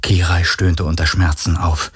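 ordinary delivery, nothing marked

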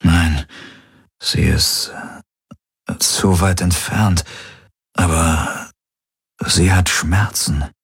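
slow, halting, breath-weak voice; groggy and weak. A narrator's reading. Patient listens inwardly and reports, weak and halting. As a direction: push out a few words at a time.

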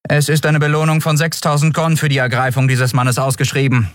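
high, even monotone announcement; toneless official. A narrator's reading. Guardswoman announcing a reward for a wanted man, toneless. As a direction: recite it tonelessly like an official notice. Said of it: "erklärte die Friedenswächterin tonlos".

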